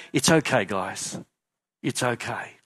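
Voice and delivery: soft, gentle reassurance to the onlookers; calm them down softly; reassuring. Acted. Jesus softly reassuring the anxious crowd, gentle and low. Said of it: "giving them that".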